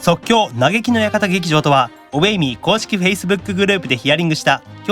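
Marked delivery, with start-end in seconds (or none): none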